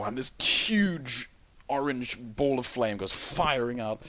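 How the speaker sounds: ordinary delivery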